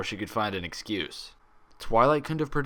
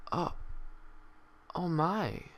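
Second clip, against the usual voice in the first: softer, higher, taken aback; stunned hush; 9 semitones higher, 9 dB quieter, slower than usual. Acted. Twilight absorbing the grim news, hushed and stunned. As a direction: let it sink in quietly.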